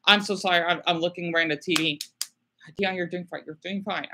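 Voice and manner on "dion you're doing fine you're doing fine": quiet, gentle reassurance; soft self-comfort. Real, not acted. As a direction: murmur to yourself kindly. Comforting herself softly.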